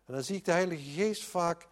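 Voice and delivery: normal talking voice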